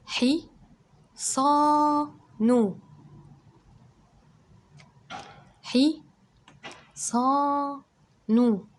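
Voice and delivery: loud, high sounding-out, stretching صا long; syllable by syllable. Shown as a demonstration. Teacher sounds out each syllable of حصان loudly for children. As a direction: call out each syllable, holding the vowel of صا. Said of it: "اسمع معايا عشان هديك مد".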